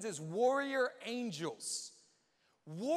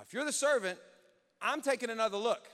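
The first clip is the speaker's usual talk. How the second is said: normal talking voice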